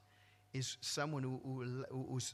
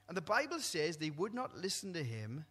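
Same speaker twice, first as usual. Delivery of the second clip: ordinary talk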